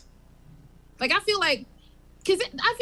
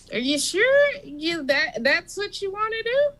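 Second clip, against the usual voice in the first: Bob the clerk; 8 semitones higher than usual. Acted. Bob talking to the president.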